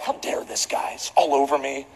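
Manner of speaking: hushed, mock-outraged grumble dropping low; retold frustration. Preacher recalling the messy seatmate, mock frustrated grumble. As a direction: grumble it under your breath, comically indignant. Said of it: "I'm literally just frustrated".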